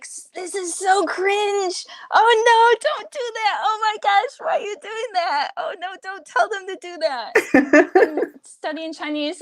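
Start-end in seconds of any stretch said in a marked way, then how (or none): 0.0-7.9 cringing dismay. Retold or imitated. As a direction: wail it like watching an embarrassing disaster. Acted reaction to bad advice.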